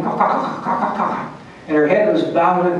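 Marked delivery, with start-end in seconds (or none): 0.0-1.3 breathy unvoiced bird cawing, caw, caw, caw; bird caw imitation. Telling friends how the angry goonie bird cawed, voiced bird caw imitation. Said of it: "Matilda was quacking".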